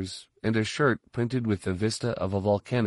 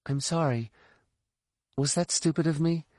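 higher, soft and sheepish; sheepish apology; 7 semitones higher, 4 dB quieter than usual. A narrator's reading. Sial apologizes to Tycho for a tactless remark, soft and sheepish. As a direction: apologize in a small high voice.

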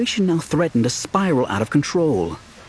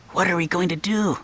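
whispered; 7 semitones higher than usual. A narrator's reading. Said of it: "Doby whispered".

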